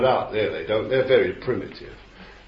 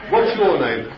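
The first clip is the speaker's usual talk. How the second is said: mock exorcist demand; 8 semitones higher, 9 dB louder than usual. Mocking exorcists questioning demons.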